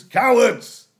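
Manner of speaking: loud, sudden jeer; shouted insult. Acted. Jeering at neutral nations in the exam, loud shout. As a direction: shout it like a heckle. Mock name for neutral Sweden.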